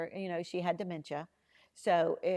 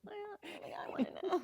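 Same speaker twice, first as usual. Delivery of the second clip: high, small, coy "I wanna know"; sheepish confession; 12 semitones higher, 5 dB quieter, faster than usual. Retold or imitated. Admitting to Steve Harvey that she herself was curious, high and coy. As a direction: own up to it like a caught kid.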